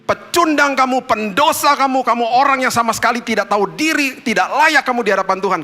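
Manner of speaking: high, loud condemning accuser; accusing voice. Acted. Accusing voice of guilt berating a sinner, high and loud. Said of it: "suara yang menuduh kita".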